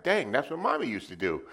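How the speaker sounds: high-pitched retold astonishment; acted realization. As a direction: act out the penny dropping. Acts out his belated realization.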